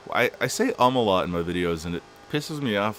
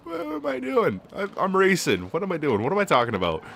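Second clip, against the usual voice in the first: much higher, louder flustered outburst; startled panic; 11 semitones higher, 5 dB louder than usual. Real, not acted. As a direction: snap back in alarm. Panics at the race.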